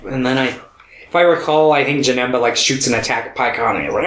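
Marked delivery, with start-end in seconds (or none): none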